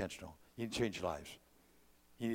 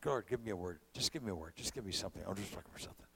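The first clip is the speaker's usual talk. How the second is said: soft, hushed plea to God; quietly asking God for a word; quiet prayer; 6 semitones lower, 11 dB quieter, faster than usual. Retold or imitated. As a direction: murmur it like a private prayer. Quoting his own quiet prayer.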